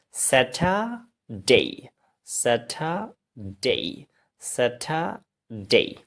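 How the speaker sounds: "স্যাটারডে" slowly as sa-tha-dei; ay-ending pronunciation. Shown as a demonstration. Teacher drills the ay ending, "স্যাটারডে" as sa-tha-dei. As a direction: split "স্যাটারডে", ending on a long gliding dei. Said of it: "এটার উচ্চারণ এই হয়".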